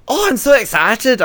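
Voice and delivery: high-pitched, failed attempt at an Irish accent; bad Irish accent. Mocking an eager Irish Eurovision entrant, botched Irish accent. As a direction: gush excitedly in a hammed-up Irish accent. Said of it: "I can't do Irish accents".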